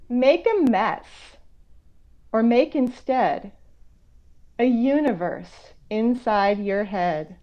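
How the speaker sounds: put-on slow, expressive read-aloud for kids; reading a picture book. Author reading her picture book to young viewers, gently expressive. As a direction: savour each rhyme for young listeners.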